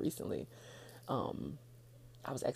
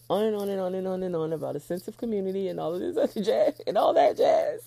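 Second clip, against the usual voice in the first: much louder and higher, building; louder, excited ramble; 6 semitones higher, 12 dB louder than usual. Real, not acted. Telling listeners she could go on forever, loud and building. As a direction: ramp up as the words pile on.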